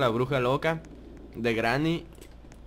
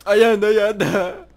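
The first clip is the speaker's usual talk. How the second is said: high, loud alarmed call; 7 semitones higher, 9 dB louder than usual. Real, not acted. Spots the killer.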